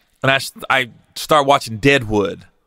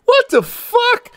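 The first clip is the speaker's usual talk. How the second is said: stunned disbelief; slower than usual. Retold or imitated. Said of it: "I'm like".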